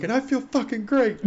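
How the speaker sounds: high, strained happy call; elated mock holler. Relieved medic calls back to his gunner, elated high holler.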